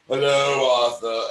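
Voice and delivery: mock English accent, drawn out; overdone plummy English greeting; exaggerated English accent. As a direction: imitate a student's hammed-up English accent. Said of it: "And he's exaggerating".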